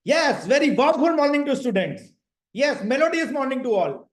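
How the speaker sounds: teacher's enthusiastic morning call; energetic greeting. Shown as a demonstration. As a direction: hook the class with a big lively hello. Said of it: "नहीं, आपको बोलना है".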